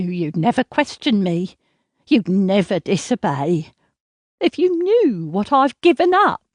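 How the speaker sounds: cling and guilt-trip her; possessive mother. Acted. Mother smothers daughter, possessive.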